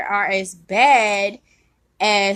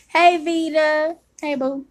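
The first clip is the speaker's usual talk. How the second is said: ordinary delivery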